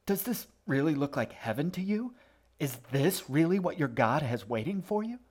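high, urgent questioning; incredulous appeal. A narrator's reading. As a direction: plead with the crowd to see sense. Boy appeals to the congregation.

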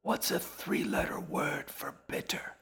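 hushed, breath-only whisper. A narrator's reading. Old woman asks Sarah for crossword help, whispering. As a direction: whisper the question with no voice at all.

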